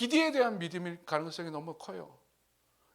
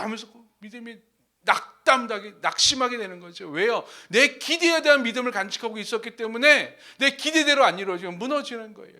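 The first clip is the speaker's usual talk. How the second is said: loud, higher-pitched sermon delivery; forceful preaching; 6 semitones higher, 10 dB louder than usual. Real, not acted. Preacher warns of faith built on expectation, preaching forcefully. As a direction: drive it home to the congregation at full force.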